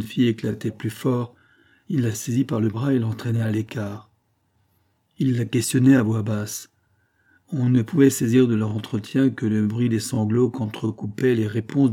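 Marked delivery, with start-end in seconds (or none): none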